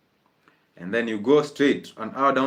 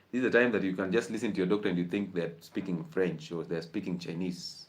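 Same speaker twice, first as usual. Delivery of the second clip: normal talking voice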